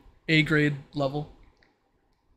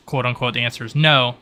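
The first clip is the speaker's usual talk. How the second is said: ordinary talk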